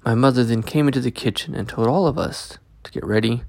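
plain reading voice

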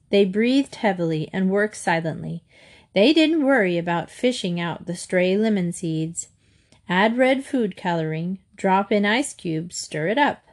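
usual speaking voice

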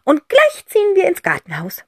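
cheerful call. Acted. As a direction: call it out happily. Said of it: "ruft fröhlich". (Tante Rotz ruft fröhlich den Kindern zu)

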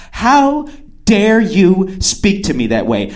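slow, full-voiced indignation; haughty, deliberate mock offence; mock outrage. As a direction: draw out each word as an imitated affront. Acting offended woman, escalating take.

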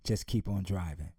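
low, calm and steady; father calmly reassuring his daughter in the storm. Acted. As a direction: reassure the frightened child. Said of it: "The father calmly looked back".

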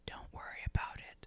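whispered, dodging the question; secretive whisper. Retold or imitated. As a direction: breathe it out as a secret that closes the topic. Acting out the matches exchange.

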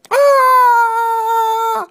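high loud scream; screaming kids. Acted. Kids scream as the lion appears, loud high shriek. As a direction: shriek in panic.

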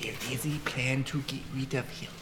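hushed whisper in her ear. Acted. Bodyguard telling a companion of the plan in her ear, whispering. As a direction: whisper it slyly, close and secret. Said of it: "Whispering in her ear".

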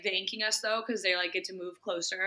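ordinary talk

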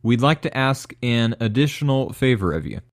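loud, upbeat podcast outro read; promo read. Acted. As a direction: read the sign-off ask like an announcer.

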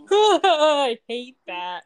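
slow, laughing "I hate that"; drawn out through a laugh; laughing disgust. Real, not acted. Reacting to shark.

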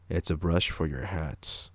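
slow, soft, flat statement of the obvious; deadpan monotone. Retold or imitated. Retelling his blunt answer to the customer, slow and deadpan. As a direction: drag it out, utterly deadpan. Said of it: "I don't know why I said it like that".

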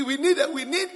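ordinary talk

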